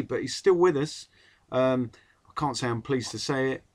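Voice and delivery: ordinary talk, nothing marked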